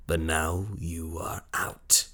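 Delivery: soft, slow whisper, savouring it; whispered slowly to the wife he has just dispossessed; slow menacing whisper. Acted. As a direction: breathe the verdict coldly. Villain dismisses wife.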